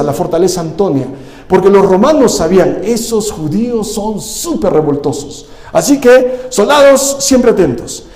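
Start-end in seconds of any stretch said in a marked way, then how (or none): 2.8-5.5 slow, softer, disdainful Roman; a lightly voiced scornful Roman. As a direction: sneer it like a wary Roman officer. Romans' thought voiced in sermon.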